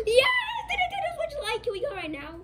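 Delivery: high, squealing cry of joy; excited. Acted. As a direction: shriek it like the best news ever. Kid thrilled about gift.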